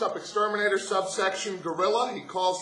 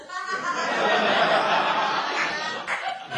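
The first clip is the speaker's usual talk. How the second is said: laughing; 8 semitones higher than usual. Real, not acted. Laughs at telling dad's jokes.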